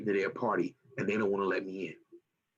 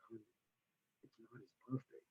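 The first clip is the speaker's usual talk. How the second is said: put-on much softer, hushed correction to his girlfriend; boyfriend's aside; 20 dB quieter, much faster than usual. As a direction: mutter it to her under your breath. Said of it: "He's like".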